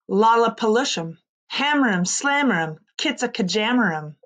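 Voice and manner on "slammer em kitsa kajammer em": usual speaking voice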